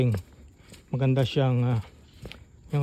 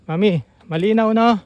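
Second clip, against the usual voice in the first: raised, higher voice calling into the radio; 9 semitones higher, 9 dB louder than usual. Real, not acted. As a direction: call out loud to be heard over the walkie-talkie. Calling out over walkie-talkie. (malakas na pagtawag sa walkie-talkie)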